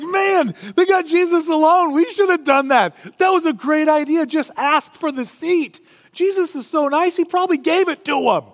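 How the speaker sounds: high, hammed-up jealous griping; a high, mock-envious disciple voice. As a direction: whine it like a disciple who missed the idea first.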